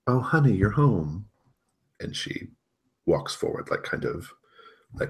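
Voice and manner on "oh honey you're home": higher-pitched doting mom act; artificial motherly warmth. Acted. Serena welcomes Ellen home, artificially warm mom. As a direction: greet with a too-sweet smile. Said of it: "kind of warm smile spreads over her face".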